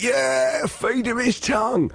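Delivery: very high, drawn-out mock cheer; baying crowd. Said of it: "People are like".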